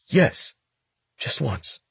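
put-on high, nervous young boy; a scared teen stammering to a gangster; frightened boy voice. Boy hesitant answer.

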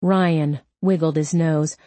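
usual speaking voice

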